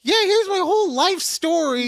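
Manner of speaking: high, loud caricature of a chatty coworker; mock oversharer. Coworkers unloading their life story, mock gushing. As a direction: gush it in a mocking voice.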